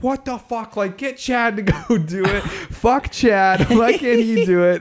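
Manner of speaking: high, loud imitated rant at Chad; mock angry outburst. Amber angrily objecting, retold.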